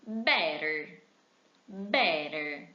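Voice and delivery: better with a flapped t, "bérer"; model pronunciation. Shown as a demonstration. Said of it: "A outra palavra".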